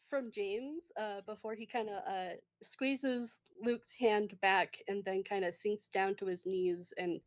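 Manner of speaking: ordinary talk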